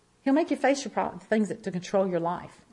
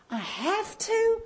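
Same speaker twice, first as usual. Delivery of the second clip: incredulous; 12 semitones higher, much slower than usual. Retold or imitated. Arguing with God in prayer.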